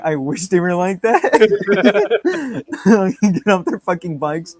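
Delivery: cracking up at polite Hell's Angels; laughing. Real, not acted. Co-host laughing at the idea of polite bikers, laughing.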